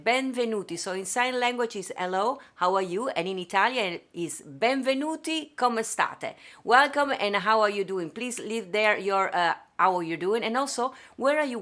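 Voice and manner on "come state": ordinary delivery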